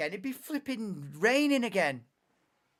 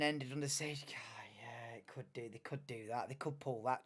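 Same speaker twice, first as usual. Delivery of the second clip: hushed whispered aside; 7 semitones lower, 9 dB quieter than usual. Real, not acted. Streamer whispering a theory to viewers, hushed whisper. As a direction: whisper it like a secret.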